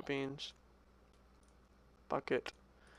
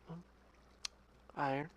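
ordinary talk, nothing marked

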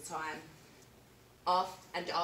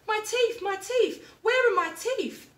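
much higher, loud frantic wail; panicked; 13 semitones higher, 10 dB louder than usual. Acted. Alan wakes without his teeth, panicking.

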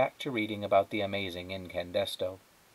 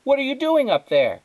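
much higher, loud call upstairs; mother shouting; 8 dB louder than usual. A narrator's reading. Mother yelling up to Flora, loud. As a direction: holler up to the room. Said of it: "her mother shouted".

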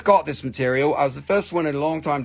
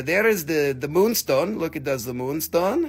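ordinary talk, nothing marked

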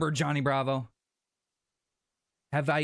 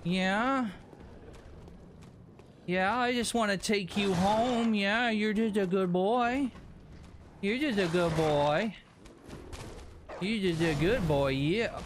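1 pet talk; 7 semitones higher than usual. Pretend. Cooing at an in-game animal.

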